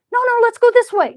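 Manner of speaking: put-on high, loud, pushy childish squabble; playful insistence. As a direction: win the squabble by volume. Current arguing direction.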